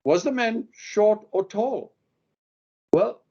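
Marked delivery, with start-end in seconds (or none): 0.0-1.9 slow question that lands its weight on "tall"; slower, with "tall" clearly stressed. Shown as a demonstration. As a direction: hit "tall" so the witness hears the answer. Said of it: "place the emphasis on the word tall".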